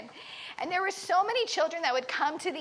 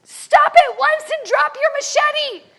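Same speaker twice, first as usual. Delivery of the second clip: commanding shout, much louder and higher; yelling; 10 semitones higher, 12 dB louder than usual. Retold or imitated. Gladys orders the charging prisoner to stop, with a commanding shout. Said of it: "she just yells".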